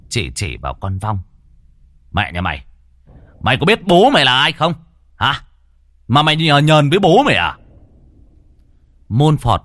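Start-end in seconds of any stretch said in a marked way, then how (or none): 2.1-7.6 loud, fast, raging tirade; furious shouting. Acted. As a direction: bellow it in his face. Exorcist furiously confronts ghost.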